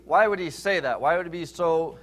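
normal talking voice

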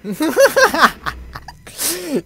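loud high gloating cackle; gloating laugh. Real, not acted. Gamer laughing after tricking the creature again, gloating laugh. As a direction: laugh hard in triumph.

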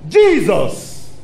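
ordinary talk

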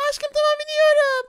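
put-on girly high spider girl voice. Spider girl character speaks. (çok ince sesli örümcek kız)

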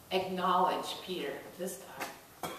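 normal talking voice

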